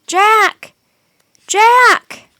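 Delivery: high, loud distant call. A narrator's reading. Annie calling for Jack lost in the storm, high and loud. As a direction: cry his name over the wind.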